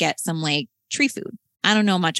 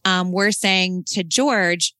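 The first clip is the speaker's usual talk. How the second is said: normal talking voice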